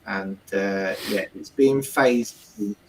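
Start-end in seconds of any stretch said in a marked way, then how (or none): none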